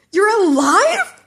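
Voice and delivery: high, loud, slow disbelief; shocked Mineta. Acted. Mineta is stunned to see Midoriya walk in alive. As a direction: gasp it in total shock.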